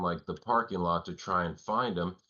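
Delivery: normal talking voice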